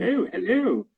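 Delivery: high-pitched, hammed-up posh English; mock posh English accent. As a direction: greet visitors in an exaggerated posh English accent. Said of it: "they thought my kids sounded really sort of".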